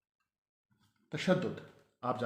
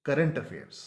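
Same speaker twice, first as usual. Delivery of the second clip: normal talking voice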